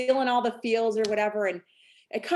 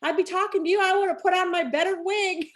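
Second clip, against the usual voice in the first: higher, laughing imitation of a friend's mother; imitating an older mom; 9 semitones higher than usual. Speaker mimicking Melissa's mother on the phone, higher and laughing. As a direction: mimic her sweetly embarrassed remark. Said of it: "And she's like".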